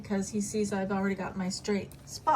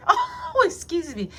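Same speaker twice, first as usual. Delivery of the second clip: much higher, louder "Oh, excuse me"; mock offended; 9 dB louder, slower than usual. Teasing Dex about what he'd say, high loud mock-offended. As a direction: fake indignation for fun.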